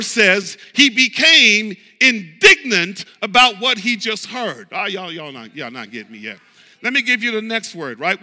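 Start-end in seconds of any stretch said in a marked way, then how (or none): none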